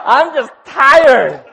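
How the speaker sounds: high, loud, drawn-out mock complaint; loud protest. [name] explaining to Helen he isn't proposing, loud protest. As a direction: plead it loudly and slowly, hammed-up.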